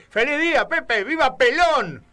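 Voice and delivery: loud, high festive shout; retold cheer. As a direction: shout it like a happy shopkeeper greeting a customer. Shopkeeper greets him festively. (grito festivo del chino imitado)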